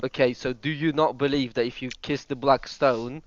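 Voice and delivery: ordinary delivery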